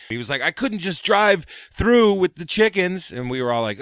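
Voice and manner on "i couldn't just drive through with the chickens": higher, slow, a bit louder pleading; slow high whiny plea; Doug's excuse. Retold or imitated. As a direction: drag out the lame excuse.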